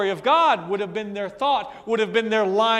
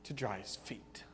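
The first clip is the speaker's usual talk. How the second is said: whisper; 10 semitones lower, 15 dB quieter than usual. Real, not acted. Hushed retelling of the anointing.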